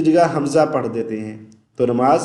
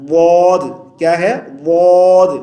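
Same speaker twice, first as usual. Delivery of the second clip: ordinary talk, nothing marked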